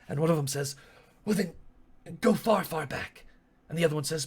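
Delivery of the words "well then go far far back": slightly higher, lightly voiced follower; urging soldier. Human soldier urges Asteri to go back, pitched up. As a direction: urge your leader, pitched up.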